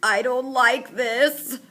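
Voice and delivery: very slow, high nasal whine. A narrator's reading. Mouth breather as crate moves, slow whine. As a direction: drag it out like a scared kid. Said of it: "whines M B".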